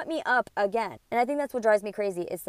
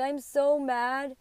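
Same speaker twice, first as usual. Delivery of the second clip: drawn out and pitched up; slow, higher mad; 5 semitones higher, much slower than usual. Retold or imitated. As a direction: friend hears her say she is so mad, drawn out higher. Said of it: "and I'm mad".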